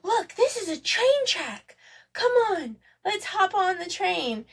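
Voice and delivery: put-on high child's voice inviting play; imitating a little girl's eager invitation; daughter excited. Mother imitates Eleanor calling everyone onto the rug train, high and eager. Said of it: "she saw 'em ... was like".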